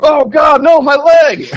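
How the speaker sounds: loud, high, agonized mock shout; Nicolas Cage. Podcaster mimicking the overdubbed torture scene, hammed-up agonized shout. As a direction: ham up an actor screaming in pain. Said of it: "hear Nicolas Cage go".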